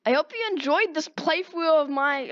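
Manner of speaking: usual speaking voice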